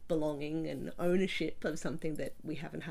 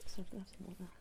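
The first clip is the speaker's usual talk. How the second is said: soft, whispery failing voice; losing voice; 2 semitones higher, 7 dB quieter, much faster than usual. Real, not acted. Interviewee asks for water, losing voice. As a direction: voice giving out, barely there. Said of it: "I'm losing my voice".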